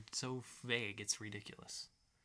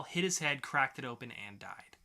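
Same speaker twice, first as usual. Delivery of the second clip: ordinary delivery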